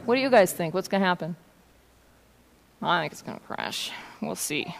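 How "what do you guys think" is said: ordinary delivery, nothing marked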